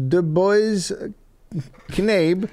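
put-on a lightly German-accented scolding, high and slow. The mistress taps a lion's nose, mild German-accent scold. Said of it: "and says".

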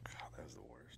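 unvoiced whispered aside. Real, not acted. Cohost whispering a complaint about the outro, whispered. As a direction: breathe it out as a secret groan.

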